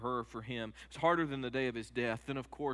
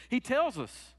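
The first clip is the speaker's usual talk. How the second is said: ordinary talk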